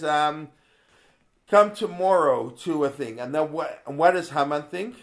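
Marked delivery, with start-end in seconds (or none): 1.5-3.2 Esther invites, voiced a touch louder; louder quote. Teacher voicing Esther inviting the king, a little louder. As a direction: call it out a bit louder. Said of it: "So she says".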